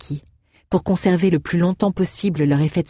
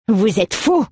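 higher, sharp outraged cry; indignant exclamation; 6 semitones higher, 3 dB louder, slower than usual. Acted. Alroeg scolding the count, outraged. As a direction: throw the accusation back at him. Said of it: "s'exclama Alroeg".

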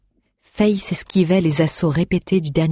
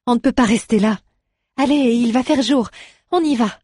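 high, pressing voice rising to the end; urging; 8 semitones higher, 3 dB louder than usual. A narrator's reading. Aléa urging the dwarf to move before daybreak, insistent and high. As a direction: push him to get up, higher and higher.

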